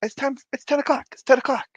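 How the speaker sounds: high, fast panicked blurt; sudden alarm at the time; panicked realization. Retold or imitated.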